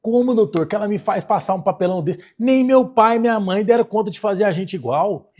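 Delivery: loud and high, indignant; outraged complaint. Retold or imitated. Sister complaining to the doctor about her twin, loud and indignant. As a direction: raise your voice in disbelief.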